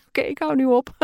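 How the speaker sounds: laughing. Real, not acted. Laughs at own failed accent.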